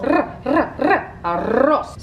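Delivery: arroz with the r rolled hard, slowed right down; rolled r demo. Shown as a demonstration. Cook teaching viewers to roll the r in arroz, slow trilled demo. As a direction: trill each r slowly, then land on arroz. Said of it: "don't know how to roll their L's".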